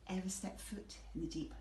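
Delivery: usual speaking voice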